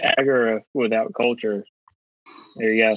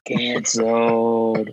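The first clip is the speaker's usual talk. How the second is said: very slow, stretched Canceled; drawn-out announcer call; much slower than usual. Acted. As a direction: drag the word out like a stamp. Stretched-out segment sound effect.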